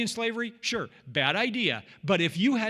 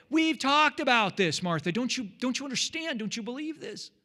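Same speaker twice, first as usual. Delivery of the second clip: pleading Jesus; 10 semitones higher, 5 dB louder than usual. Acted.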